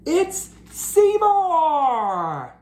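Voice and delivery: high, slow, drawn-out amazed cry; surprised shout. Acted. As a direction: stretch out the surprise. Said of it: "cats spotting Seymour".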